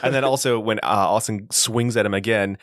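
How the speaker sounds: normal talking voice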